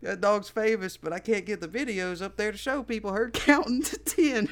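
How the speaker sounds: loud, high boast turned excuse; hammed-up imitation of the dog's owner; retold dog owner. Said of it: "he's just like".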